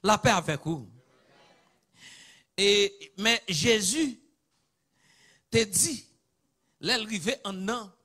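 normal talking voice

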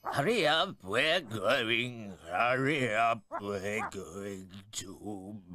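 winding-down voice, slurring to a halt; slow down and slur like a clock running out; slurred and slowing down. A narrator's reading. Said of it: "his speech was getting rather slurred".

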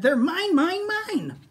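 high, loud greedy dino cry; greedy Minosaur. Acted. Minosaur claims the snacks, high and loud. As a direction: grab the food and claim it. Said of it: "bit the butter tarts and said".

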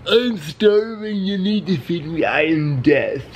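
slow, high, dramatic starving cat. Pretend. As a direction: voice the cat as the grim reaper. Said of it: "how my cat sees himself".

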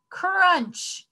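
high, punchy crunch sound; loud crunch. A narrator's reading. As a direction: bite down on the word. Shark bites, story sound.

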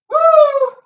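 very high, loud whoop; excited whoop. Real, not acted. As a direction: shout it out as the set ends. Cheering as set ends.

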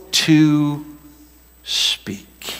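"to speak" drawn out, sinking to a hush; deliberately slow. Shown as a demonstration. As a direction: act out slowness, fading away. Acts out being slow to speak.

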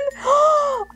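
high, excited gasp; delighted gasp. Real, not acted. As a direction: suck in air with delight. Gasp at cute mugs.

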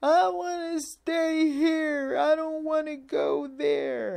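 put-on high, slow, whining toddler voice; mock toddler crying for a toy; whiny two-year-old. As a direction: whimper like a toddler who won't leave.

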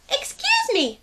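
a tiny penguin full of questions; little penguin. Acted. Story reader voicing small penguin Pipkin asking big questions. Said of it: "said Pipkin".

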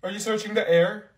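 usual speaking voice

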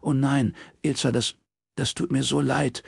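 pained and halting sympathy; shocked sympathy. A narrator's reading. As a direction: console a friend in dismay, voice lifted. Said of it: "keuchte Robin".

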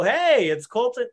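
high, slow sing-song greeting; mock telemarketer lilt; cheesy inflection. Shown as a demonstration. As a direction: overdo the fake-friendly hello. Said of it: "You don't use that inflection".

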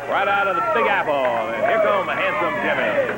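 hyped ring announcer intro; wrestling announcer. Real, not acted. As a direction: build him up like a big entrance.

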